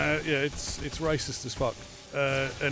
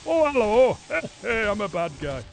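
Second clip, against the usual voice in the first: put-on loud northern-accent villain greeting; hammed-up northern bad guy accent; mock northern accent; 5 semitones higher, 7 dB louder than usual. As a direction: announce yourself as a northern baddie, accent laid on thick. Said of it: "the bad humans are all up north".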